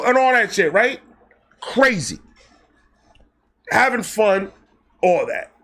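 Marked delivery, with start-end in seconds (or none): none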